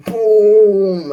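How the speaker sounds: a huge high shouted boom; big boom. Acted. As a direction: blast the word out. Demon bursts out of cauldron.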